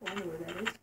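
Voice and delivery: normal talking voice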